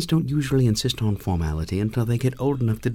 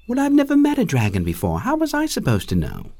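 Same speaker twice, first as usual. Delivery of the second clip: high, loud, defensive comeback; defensive protest; 12 semitones higher, 4 dB louder than usual. A narrator's reading. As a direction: protest your innocence, voice shooting up. Stung reply to indignant dragon.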